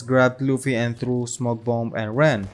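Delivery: ordinary delivery, nothing marked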